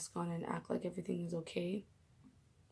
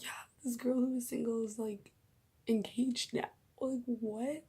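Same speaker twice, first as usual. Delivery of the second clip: reenacted giddy disbelief, higher pitched; freaking out; 5 semitones higher than usual. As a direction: blurt out the big news, high and amazed. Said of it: "freaking out at work".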